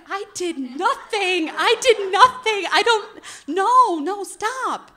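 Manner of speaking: high, loud, rushed denial; panicked protest. Retold or imitated. To the jealous girlfriend, panicked high denial. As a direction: squeal out your innocence, half laughing.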